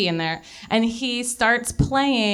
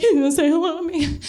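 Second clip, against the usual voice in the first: high eager; 7 semitones higher, faster than usual. Retold or imitated. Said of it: "I was like".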